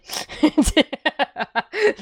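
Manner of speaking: high wicked cartoon laugh; evil villain cackle. Shown as a demonstration. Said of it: "Le rire diabolique".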